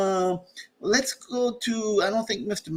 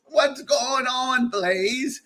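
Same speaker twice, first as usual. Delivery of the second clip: ordinary talk, nothing marked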